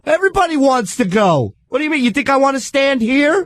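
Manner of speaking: high-pitched indignant comeback; retold exasperation. Answering the pushy lady on the packed train, exasperated.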